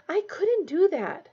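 high, slow, scandalized; shocked at the very idea. Acted. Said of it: "looking scandalized".

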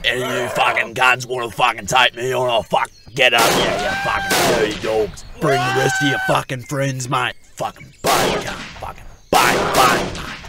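loud, brash taunting shouts; bellowed challenge. Acted. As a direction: yell it out to pick a fight. Drunk challenging the creatures.

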